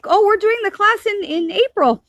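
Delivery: high, loud, gushing friendly invitation; high eager call to join in; excited invite. Retold or imitated. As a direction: imitate them bubbling over with excitement. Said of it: "they're like".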